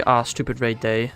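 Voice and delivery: ordinary delivery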